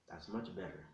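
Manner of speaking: plain reading voice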